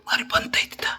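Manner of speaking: soft unvoiced aside; whisper. Real, not acted. Owner whispering to the dog about the kitchen. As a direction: whisper it, as if not to wake anyone. Tells dog food is cooking.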